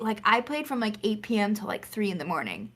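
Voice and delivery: ordinary talk, nothing marked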